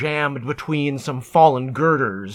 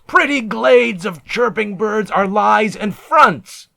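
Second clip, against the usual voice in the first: higher and louder, jeering; scornful shipmates; 7 semitones higher, 5 dB louder than usual. Acted. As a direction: sneer it like a hardened spacer. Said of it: "my mates informed me".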